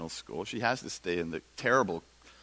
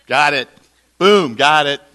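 louder, sarcastic got it; mock loud; 2 semitones higher, 14 dB louder than usual. Disciples loudly pretending they understand. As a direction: bark it out mockingly.

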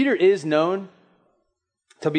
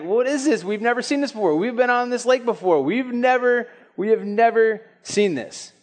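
freaked-out disciples; 7 semitones higher than usual. Acted.

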